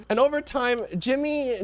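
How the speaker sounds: ordinary delivery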